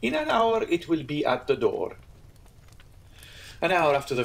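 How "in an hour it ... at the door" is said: higher, eager landlord echo; lightly voiced obliging innkeeper; higher, promising innkeeper. Pastrini replies.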